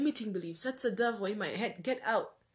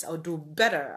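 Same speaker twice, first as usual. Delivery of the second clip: ordinary talk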